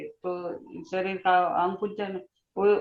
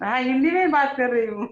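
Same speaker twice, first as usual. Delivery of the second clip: quick, loud amused insistence; excited protest; 5 semitones higher, 5 dB louder, faster than usual. Real, not acted. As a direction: protest quickly, high and laughing. Laughing protest at teasing.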